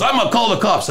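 high-pitched protest; imitating a rattled man; retold alarmed threat. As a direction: blurt it out, voice jumping up.

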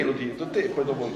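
ordinary talk, nothing marked

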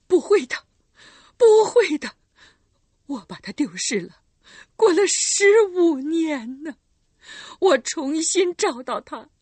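loud, high, slowing denial; desperate protest. Acted. Recluse protesting the soldiers, desperate loud denial. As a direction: refuse it with all your strength. Mother panics at losing daughter.

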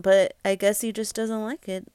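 plain reading voice

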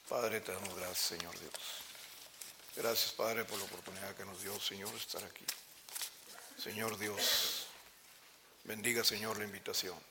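low, soft, reverent prayer voice. Acted. Preacher leads the congregation in closing prayer, soft and reverent. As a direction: pray humbly to God, drawing the sermon to a close.

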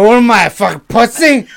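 loud, high hammered bar order; mock drunken shout. Hammered customer ordering at the bar, mock drunken shouting. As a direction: bellow it like a comic drunk. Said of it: "I was fucking hammered".

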